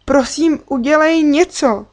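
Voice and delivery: high, loud desperate plea; begging. A narrator's reading. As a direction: beg a friend to step in. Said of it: "Stála jsem tam se sepjatýma rukama a prosila".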